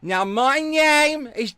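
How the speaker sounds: high, loud, slow put-on introduction; exaggerated high-voiced politician send-up; AOC. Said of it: "A O C impression then".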